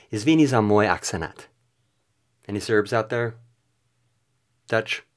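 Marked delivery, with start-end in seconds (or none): 0.1-1.5 Serbian attempt run together in one go; louder, more connected stab at Serbian; butchered Serbian. Shown as a demonstration. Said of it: "And now for Serbian".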